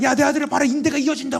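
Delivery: very high, loud, jubilant shout; God's exultant call. Retold or imitated. Imagined God showing his son healed, jubilant shout. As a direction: announce the miracle like a triumphant father.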